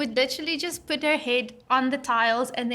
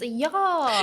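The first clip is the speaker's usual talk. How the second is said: South African accent. Shown as a demonstration. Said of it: "the South African accent is".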